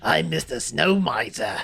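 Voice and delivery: slow, laughing cartoon voice; a hint of a cartoon villain, slow; Snow Miser voice. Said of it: "It sound like Ed".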